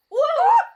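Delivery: loud, rising high cry; falling yell. Acted. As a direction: yell as you topple over. Falls through fog.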